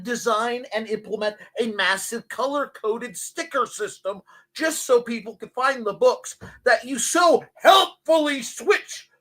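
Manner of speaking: high, mounting rant with punched words; exasperated rant. Pretend. Librarian scolding an author over series numbering, exasperated rant. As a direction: build to a high, loud pitch of exasperation.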